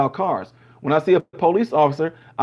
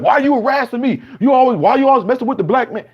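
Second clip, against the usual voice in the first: higher, louder pretend outrage at the cop; mock irate outburst; 7 semitones higher, 3 dB louder than usual. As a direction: play the indignant hothead, half-acted. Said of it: "I'm getting all irate like that".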